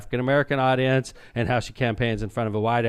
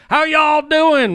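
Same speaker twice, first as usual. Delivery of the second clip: mock Southern drawl, much higher and louder, slow; fake Southern accent; 9 dB louder, much slower than usual. Coach imitating his Louisiana intro in fake Southern accent. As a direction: ham up a phony Southern greeting. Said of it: "acting like he's from the South".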